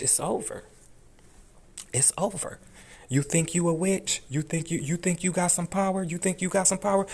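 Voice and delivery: high mocking taunt. Real, not acted. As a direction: scoff at her in a high voice. Mocking the judge's power.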